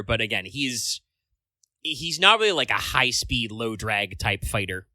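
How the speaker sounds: usual speaking voice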